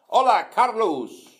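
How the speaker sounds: high, loud, drawn-out hello; hearty greeting. Retold or imitated. Boss greeting his worker with a loud, cheerful hola. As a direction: call out a big friendly hola. Manager greets him, retold.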